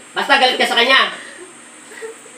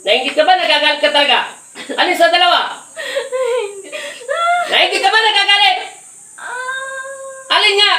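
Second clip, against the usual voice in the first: high, raised-voice interrogation; insistent questioning; 10 semitones higher than usual. Real, not acted. Healer demands answers from the crying patient, high and insistent. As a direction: press hard for an answer, high and forceful.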